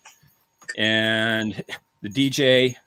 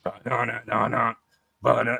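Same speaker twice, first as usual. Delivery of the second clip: lazy mock guitar riff; hummed guitar riff. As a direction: sing the riff like the record. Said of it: "And it was".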